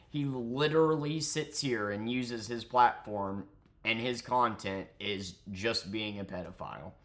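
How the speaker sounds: ordinary delivery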